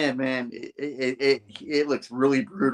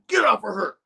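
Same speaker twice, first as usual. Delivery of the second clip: high, urgent mock shout; rescuer's command; 10 semitones higher, 3 dB louder than usual. Spidey to the attacker, high urgent command. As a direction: order it as the hero bursting in. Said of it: "He's like".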